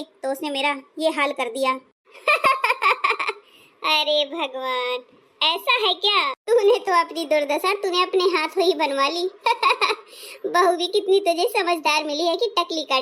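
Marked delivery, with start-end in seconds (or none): 2.3-3.3 high burst of laughter; high, amused cackling; laughing. Acted. Friend laughs at shaved-head tale. 9.3-9.9 short, high laugh; a quick burst of mocking laughter. Friend mocks the shaved head.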